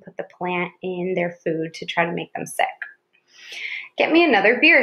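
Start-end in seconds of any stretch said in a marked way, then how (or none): 4.0-4.8 loud, higher barked order; gruff shout. A narrator's reading. A guard shouting for another beer. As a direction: shout it across the shed. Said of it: "shouted the gruff guard".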